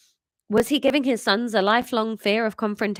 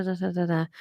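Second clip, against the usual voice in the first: ordinary delivery, nothing marked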